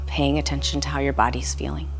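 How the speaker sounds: calm meditation voice with long pauses; guided meditation. Real, not acted. Instructor guiding listeners through a body scan, calm meditation voice. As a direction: lead a body scan gently, leaving room to breathe.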